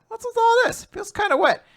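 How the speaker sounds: mock-bewildered, as if poking the damp wall; puzzled squeak. Narrating Yuiga finding the wet wall, a high puzzled voice.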